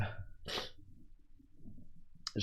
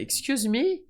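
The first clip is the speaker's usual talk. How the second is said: put-on high, light girl's voice asking politely; imitating a girl; much slower than usual. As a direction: ask sweetly like a shy fan. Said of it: "elle fait".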